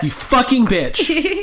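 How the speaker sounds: high, drawn-out hammed-up insult; exaggerated cursing on the edge of laughter; mock outraged curse. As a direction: stretch it out high in playful outrage. Echoes the acted curse, laughing.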